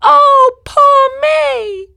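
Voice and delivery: high, loud wailing self-pity; high tearful wail; sobbing lament. A narrator's reading. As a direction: wail it through tears. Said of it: "burst into sobs and tears".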